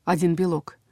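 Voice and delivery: ordinary delivery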